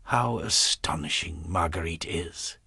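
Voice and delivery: soft unvoiced whisper. A narrator's reading. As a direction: whisper it to yourself. Philippe's inner thought.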